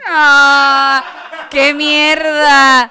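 loud, drawn-out cry of outrage; outraged shout. Real, not acted. Hearing Johnny's cold phone answer, outraged shout. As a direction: yell in mock disgust.

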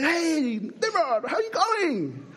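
high, slow, laughing mock hello; mocking greeting. Teacher mock-greeting the pastor on court, high and laughing. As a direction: sing out the insult like a jolly hello. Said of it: "greeted me with an awesome, you know, greeting".

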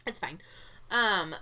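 normal talking voice

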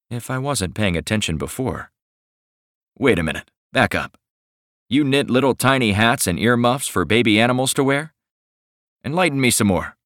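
ordinary delivery

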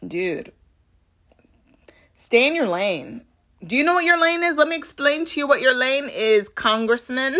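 high, loud condescending explaining; imitating a firm rebuke to a congressman; stay in your lane. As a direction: spell it out to him like a child. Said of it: "like she was reminding him".

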